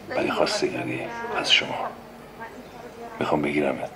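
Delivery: hushed, breathy whisper; soft secret whisper to the woman. Acted. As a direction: lean in and whisper the proposal. Whispered proposal.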